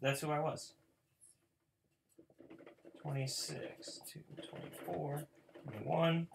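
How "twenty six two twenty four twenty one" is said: whispered count under breath; muttered counting. Real, not acted. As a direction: count quietly to yourself. Counting cards to himself.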